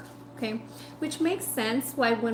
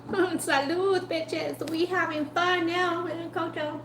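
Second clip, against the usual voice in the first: playful toast; 6 semitones higher, much slower than usual. Real, not acted. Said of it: "playful toast".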